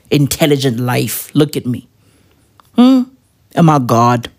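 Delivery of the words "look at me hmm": ordinary talk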